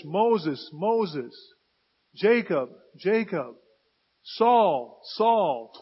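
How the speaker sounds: loud, emphatic divine summons; calling by name. Acted. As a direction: call each name out firmly, letting it fall. God calls each name twice.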